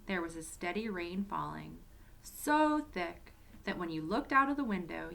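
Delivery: ordinary delivery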